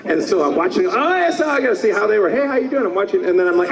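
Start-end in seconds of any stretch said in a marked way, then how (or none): 0.9-2.9 high-pitched, exclaiming hello to friends; excited mock greeting. Actor greeting his friends' voices while watching, mock excited. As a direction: sing out a delighted greeting, hamming it up. Acts out distracted dub watching.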